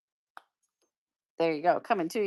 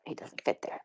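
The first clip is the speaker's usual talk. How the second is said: whispered aside; 14 dB quieter than usual. Real, not acted. Muttered aside while arranging pins.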